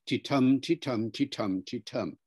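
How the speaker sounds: "ti-tum" with weak TI, strong TUM; lilting "ti-tum" beat, falling away in level; stress-timed rhythm. Shown as a demonstration. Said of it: "stress timed English I'm using now".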